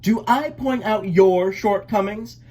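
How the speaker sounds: high, loud retort; sharp duckling comeback; snapping back. A narrator's reading. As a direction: snap it back at the catcher. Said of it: "Elmer snapped back".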